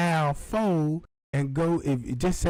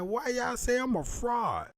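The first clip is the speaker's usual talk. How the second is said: indignant high; 7 semitones higher, 5 dB quieter than usual. Retold or imitated. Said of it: "I'm like".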